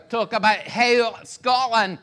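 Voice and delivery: slow, loud, exaggerated Scottish accent; strong Scottish accent. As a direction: draw it out like a Scottish driver. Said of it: "it was that strong of an accent".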